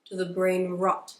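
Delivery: slow, theatrical olde-English oratory; mock-solemn, stretched-out announcement; mock-archaic proclamation. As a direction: proclaim it grandly like a storybook sage, drawn out. Mock-archaic proclamation opening the video.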